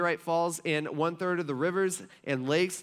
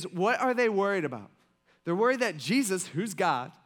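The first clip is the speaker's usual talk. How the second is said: ordinary delivery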